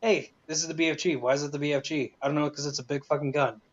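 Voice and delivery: ordinary delivery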